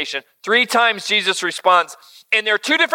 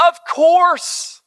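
brash arrogance; 8 semitones higher than usual. Retold or imitated. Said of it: "brashly, arrogantly said".

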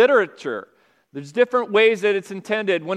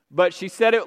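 ordinary talk, nothing marked

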